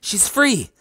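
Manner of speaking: very high, elated exclamation; high-pitched joyful call; excited cry. A narrator's reading. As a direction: cry out with relief. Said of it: "I cried".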